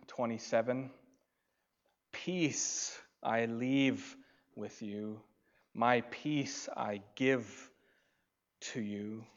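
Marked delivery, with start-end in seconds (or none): 2.1-9.3 very slow solemn "Peace I leave"; slow scripture reading. Acted. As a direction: draw out each word. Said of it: "reads scripture".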